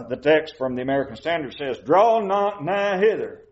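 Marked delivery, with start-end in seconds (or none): 1.8-3.4 put-on slow, lifted, formal old-English phrasing; solemn old-fashioned wording, drawn out; archaic King James reading. Shown as a demonstration. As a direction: read it like stately old scripture. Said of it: "that's not how I would say it".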